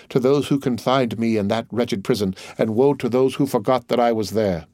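ordinary delivery, nothing marked

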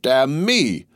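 indignant outburst, moderately voiced; exclaiming. A narrator's reading. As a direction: cry out in disbelief. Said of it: "cried Stryver".

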